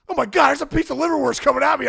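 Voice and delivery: high-pitched comic panic; mock alarm. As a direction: exclaim as if something gross is flying at you. Food flying at his face.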